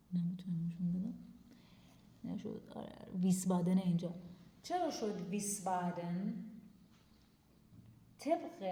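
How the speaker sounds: ordinary talk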